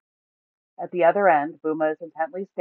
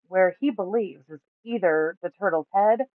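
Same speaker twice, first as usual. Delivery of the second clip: ordinary delivery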